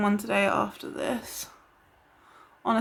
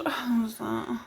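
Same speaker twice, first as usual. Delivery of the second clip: startled whisper; hushed, wondering what that noise was; 7 dB quieter than usual. Real, not acted. Startled by a noise mid-video.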